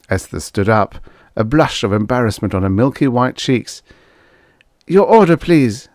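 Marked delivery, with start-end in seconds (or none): 4.9-5.9 loud, higher-pitched formal request; stiff. A narrator's reading. Embarrassed waitress takes the trucker's order, stiffly. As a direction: ask stiffly, keeping it official. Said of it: "she said to Brack stiffly".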